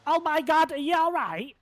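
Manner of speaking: loud, high burst of alarm; alarmed in-character shout. Acted. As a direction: rush over, loud and worried. Character rushes to a hurt stranger.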